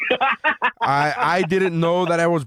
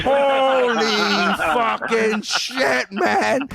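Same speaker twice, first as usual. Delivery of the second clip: high, drawn-out amazement; astonished outburst; 8 semitones higher, much slower than usual. Retold or imitated. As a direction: stretch out the stunned exclamation, way up high. Recounts astonished reaction to film scene.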